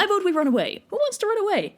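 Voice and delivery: high, eager cowardly pitch; rally everyone to flee; whiny suggestion. Acted. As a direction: Agamemnon proposing retreat in a high whiny voice. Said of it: "So Agamemnon's like".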